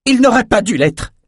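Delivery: very high, anguished cry; cri de protestation. A narrator's reading. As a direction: cry out, voice leaping up. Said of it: "cria Thora". (cri aigu et révolté)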